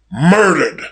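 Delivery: single word, let fall after a pause; stunned realization. A narrator's reading. Billy Kane grasps that Ellsworth is dead, stunned. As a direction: take in the dreadful news, stunned. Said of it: "seemed to clear Billy Kane's brain".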